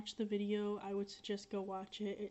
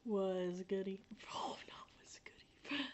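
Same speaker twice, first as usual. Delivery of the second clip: whispered laugh. Real, not acted. Giggling whisper about ex.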